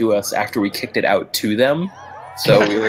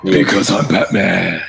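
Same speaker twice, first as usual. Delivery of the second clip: slow gravel-free Batman growl impression; 2 semitones lower, 5 dB louder, slower than usual. Batman catchphrase in skit.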